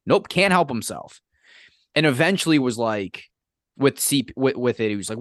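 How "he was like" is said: ordinary talk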